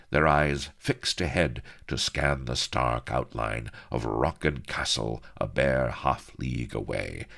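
plain reading voice

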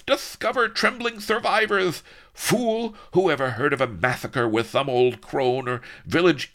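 loud, high barked commands; blaring orders. Acted. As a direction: bellow orders like a spoilt tyrant. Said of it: "blared Don Lardo".

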